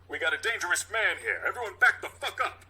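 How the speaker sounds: high, loud warning to the crowd; yelling at crowd. Acted. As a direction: clear the area like a cop.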